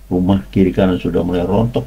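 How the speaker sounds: ordinary talk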